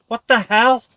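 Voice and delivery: loud, high shocked exclamation; loud, surprised what-the-hell; startled outburst. Real, not acted. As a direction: caught off guard, blurt it. Sudden surprise in game.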